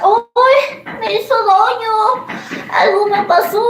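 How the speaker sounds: high, whimpering mock complaint; whiny husband. Wife mimicking her husband whining that food upset him. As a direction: play the husband moaning that the food hurt him.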